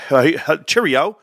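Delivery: a hint of a British accent, lightly mocked; mock British greeting. Retelling how the UK army guy greeted him, mock British. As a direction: toss off a hammed-up British cheerio. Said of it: "he's like".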